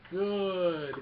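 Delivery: mock villain delight; gloating Emperor. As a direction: gloat over it, stretching the word. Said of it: "the Emperor's just having a ball".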